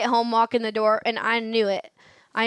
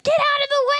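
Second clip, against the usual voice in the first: much higher and louder driver's yell; mock road-rage yell; 14 semitones higher, 3 dB louder than usual. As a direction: bark it at the car ahead. Friend yelling while driving.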